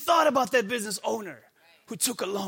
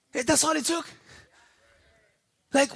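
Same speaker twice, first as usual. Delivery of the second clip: ordinary delivery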